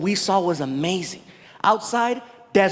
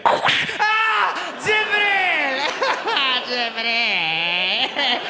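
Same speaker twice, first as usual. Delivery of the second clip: high, drawn-out cry with laughing; mock cry; 5 semitones higher, much slower than usual. Comic imagines what will happen, high mock cry. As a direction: wail it high, then let it sink.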